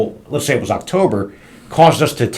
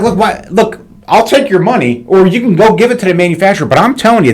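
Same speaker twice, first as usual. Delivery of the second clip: retold heated retort; 7 semitones higher than usual. Owner retelling how he told the banker off, heated. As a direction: replay how he argued with the bank, higher and insistent.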